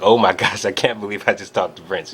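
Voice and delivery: loud, laughing gush of disbelief; starstruck excitement. Retold or imitated. Fan leaving [name]'s trailer, giddy and laughing. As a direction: blurt it out giggling and giddy. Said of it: "just, like, shaking".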